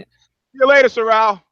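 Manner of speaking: calling out. Real, not acted. Host calls goodbye to teammate Sorrel, loud. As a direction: call out goodbye to him.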